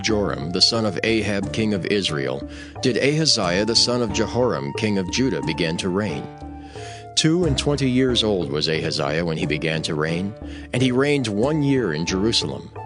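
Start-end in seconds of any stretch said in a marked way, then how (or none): none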